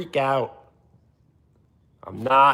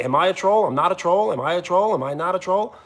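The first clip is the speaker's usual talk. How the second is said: higher repetitive chant, every phrase tipping upward; mocking a pestering viewer; 5 semitones higher, faster than usual. Streamer warning a viewer who keeps asking, mocking imitation. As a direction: mimic relentless needy questioning. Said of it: "If you keep saying".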